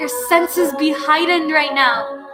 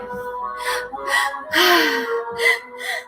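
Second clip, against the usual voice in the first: long sighing exhale; 4 dB quieter than usual. Shown as a demonstration. Demonstrating the release breath.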